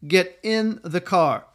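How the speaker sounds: "Get in the car." said slowly, each word weighed; slow, firm "Get in the car."; slow deliberate command. Shown as a demonstration. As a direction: parent losing patience, slow and firm. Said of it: "say the same words in a lot of different ways".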